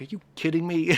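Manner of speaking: incredulous at the broken audio; exasperated disbelief. Retold or imitated. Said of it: "I was like".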